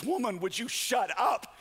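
henpecked husband losing it; exasperated outburst. Acted. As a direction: snap at your wife in mock fury. Husband snapping at wife in joke.

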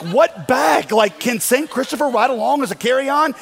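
high, loud mock disbelief; loud incredulity. Pastor questioning a stranger about his bag, loudly incredulous. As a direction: blurt it out, comically baffled.

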